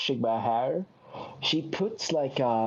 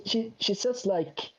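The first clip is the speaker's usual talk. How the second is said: ordinary delivery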